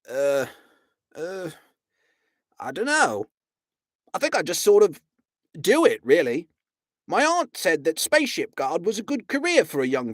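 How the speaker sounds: bashful guard voice; fumbling Vogon guard. A narrator's reading.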